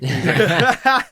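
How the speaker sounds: high, loud laughing lead-in. Real, not acted. Friend cracking up retelling the [name] bit, laughing. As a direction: laugh through the words.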